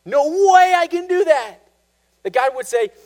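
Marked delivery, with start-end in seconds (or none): 0.0-1.6 a monk's loud despairing outburst; despairing cry. Retold or imitated. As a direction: cry out in hopeless frustration. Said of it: "it's like".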